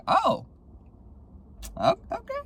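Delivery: very high, squeaky little reaction; flustered reaction. Retold or imitated. Reacting to a message from the ex, high flustered squeak. Acting reaction to ex's text.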